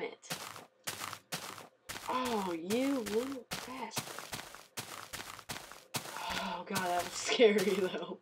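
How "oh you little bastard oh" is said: ordinary delivery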